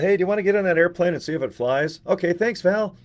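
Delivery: high, loud, fast little acted dialogue; excited mock exchange. The buddy bouncing a test-pilot ask at Val, high and excited. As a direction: play both sides brightly with a lift.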